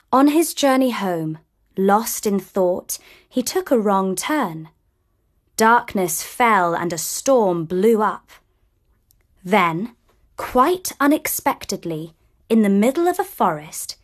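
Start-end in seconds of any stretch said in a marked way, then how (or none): none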